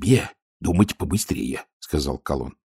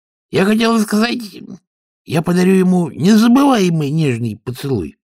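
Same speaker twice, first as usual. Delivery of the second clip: high, loud mock-tender threat; a loud, high, sickly-sweet menace; menacingly sweet; 12 semitones higher, 5 dB louder than usual. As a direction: purr a threat as a kiss. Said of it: "угрожающей сладострастной улыбке".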